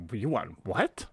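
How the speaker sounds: incredulous question, rising on "what"; retold puzzlement, asking her to repeat; baffled. Baffled son asks again.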